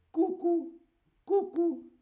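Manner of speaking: very high cuckoo "cucú"; cuckoo call. Shown as a demonstration. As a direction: chirp "cucú" like a clock bird. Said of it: "sale el pájaro y dice". (imitando el cucú muy agudo)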